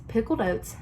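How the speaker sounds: ordinary delivery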